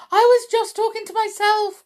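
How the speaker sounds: very high, loud, fast panic; wretched cry. A narrator's reading. Wretched witch excuses herself, high panicked cry. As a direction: squeal the excuse in terror. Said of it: "cried the wretched witch".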